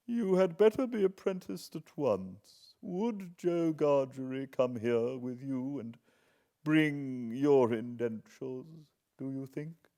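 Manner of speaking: a hint of a brisk old woman; old lady. Miss Havisham orders.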